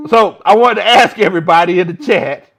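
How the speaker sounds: loud call to chat. Real, not acted. Addressing the chat.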